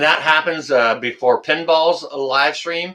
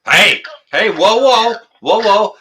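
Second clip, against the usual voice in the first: mock-stern slow down, louder; playful whoa; 5 semitones higher, 4 dB louder than usual. Real, not acted. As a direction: rein him in with a grin. Playfully reining in caller.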